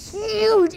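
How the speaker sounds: "huge" drawn out long and high; stress on huge. A narrator's reading. Storyteller describing the giant troll, "huge" hugely drawn out. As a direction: stretch "huge" slowly with pitch way up.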